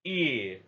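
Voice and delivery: "ear" gliding toward the schwa; teacher modelling the ear diphthong; centering sound. Shown as a demonstration. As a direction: let "ear" slide to the centre.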